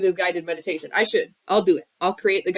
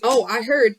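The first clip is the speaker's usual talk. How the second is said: ordinary talk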